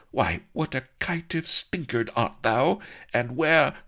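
high, melodramatic lady's tirade; indignant demand. A narrator's reading. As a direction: tear into him, pitched high throughout. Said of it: "she demanded".

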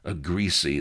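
ordinary delivery

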